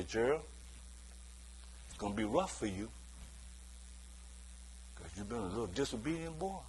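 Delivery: low, soft deathbed warning to a son; a dying parent warns the wayward son, low and soft; hushed dying parent. Retold or imitated. Dying parent warns disobedient son, low and soft.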